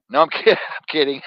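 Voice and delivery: ordinary talk, nothing marked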